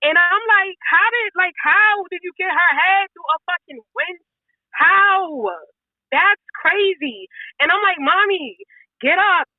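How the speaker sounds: high, upset voice full of disbelief; distraught. Real, not acted. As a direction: relive the shock, voice pitched high. Reliving finding her injured mother.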